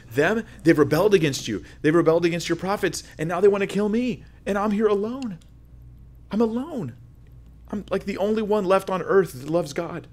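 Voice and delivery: high-pitched, self-pitying protest to God; aggrieved lament. Acted. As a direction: plead like the only loyal one left. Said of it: "That's how he felt".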